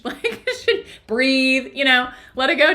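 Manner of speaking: put-on boyfriend's teasing, laughed out; imitating laughing teasing. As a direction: laugh through mock advice. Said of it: "he just started laughing".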